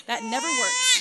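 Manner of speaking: loud, high-pitched frustrated complaint; mock exasperation. Mother as baby wails afresh, mock exasperated. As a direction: throw it out in comic defeat. Baby starts crying again.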